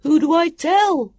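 high, loud, slow call; drawn-out high-pitched shout. A narrator's reading. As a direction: shout the question from afar. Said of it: "Then Sam called".

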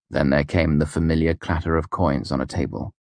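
ordinary delivery

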